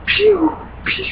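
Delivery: high, quick pew pew blasts; laser sound effect. Retold or imitated. Gamer imitating his laser weapon firing. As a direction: mimic a sci-fi laser gun firing.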